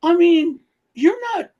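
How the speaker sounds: high, loud exasperated yelling; furious rant at the agent; angry outburst. Real, not acted. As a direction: blast him with disbelief.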